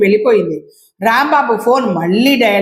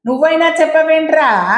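much higher, exasperated scolding; embarrassed boss scolding his assistant; lashing out; 6 semitones higher than usual. A narrator's reading. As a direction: turn on the assistant in frustration. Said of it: "Kishtaiah మీద విరుచుకుపడ్డాడు".

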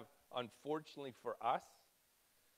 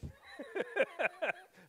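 high, helpless burst of laughter; amused laugh; 5 dB louder than usual. Real, not acted. Speaker laughs at saying mom for wife, amused laugh. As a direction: crack up at your own slip.

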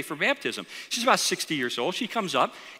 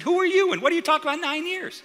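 high-pitched, puzzled questioning; retold bafflement; 10 semitones higher than usual. Pastor questions the weeping woman he didn't know, high and baffled. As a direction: act out being thrown by a stranger's words.

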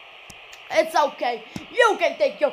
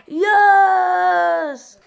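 long, loud celebratory yell; triumphant shout; 3 semitones higher, 10 dB louder than usual. Real, not acted. Gamer celebrating a moment in the match, triumphant shout. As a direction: let out a huge drawn-out cheer.

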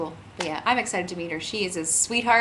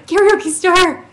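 announcer voice; 10 semitones higher, 11 dB louder, slower than usual. Acted. Announcing the show name.

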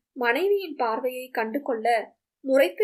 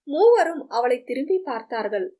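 ordinary delivery, nothing marked

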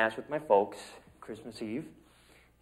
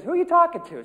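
sister calling from the background; 6 dB louder than usual. Retold or imitated. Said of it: "she goes".

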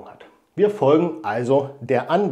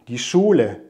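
ordinary delivery

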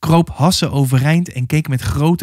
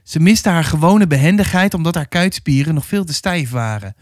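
ordinary delivery

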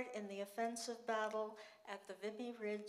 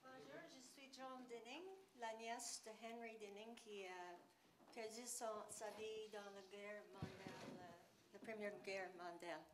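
broken French; 12 dB quieter than usual. Real, not acted. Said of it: "mon français est pas parfait".

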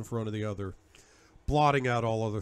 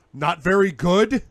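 loud, higher incredulous bellow; 6 semitones higher, 11 dB louder than usual. Acted. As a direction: roar it back in disbelief. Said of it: "My bellow echoes".

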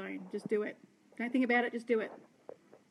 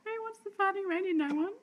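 playful; 9 semitones higher, 3 dB louder than usual. Real, not acted. Playful appeal to viewers.

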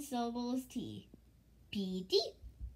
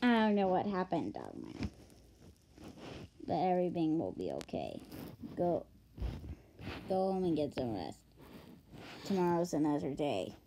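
gently consoling; 5 semitones lower than usual. Acted. Character sends Dogman home to rest, gently consoling. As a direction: console a downhearted friend in a low voice.

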